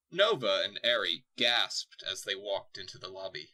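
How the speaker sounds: normal talking voice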